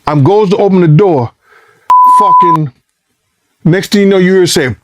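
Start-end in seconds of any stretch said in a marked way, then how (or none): none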